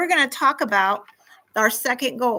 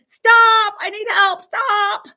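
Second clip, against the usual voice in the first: high, loud call to the teacher; child calling out for help; 9 semitones higher, 8 dB louder, slower than usual. Pretend. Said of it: "So I raise my hand".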